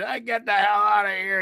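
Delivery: lightly voiced angry general; general's order. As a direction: shoo him off. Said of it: "he just looked at him and he said".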